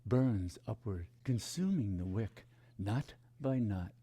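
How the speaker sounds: slow, low, devotional reading over music; poem recitation. Acted. Spiritual teacher reciting a poem to students, slow and reverent. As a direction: let each image burn slowly, fading to a hush.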